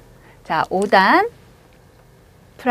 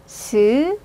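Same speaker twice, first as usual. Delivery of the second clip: ordinary talk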